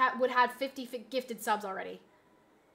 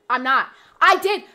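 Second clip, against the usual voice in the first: ordinary talk, nothing marked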